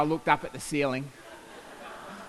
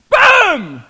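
loud boom; 13 semitones higher, 15 dB louder than usual. Retold or imitated. Explosion in story.

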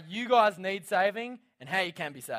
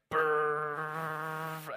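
long rolled "brrr"; imitated runaway chipper noise. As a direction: mimic a machine rumbling away with a sustained trill. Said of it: "the chipper just goes".

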